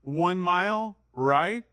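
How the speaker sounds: very slow, one keyword at a time; slow simple directions. Retold or imitated. Giving directions to a non-native speaker, very slow. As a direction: spell it out slowly for a learner. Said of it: "I'm like".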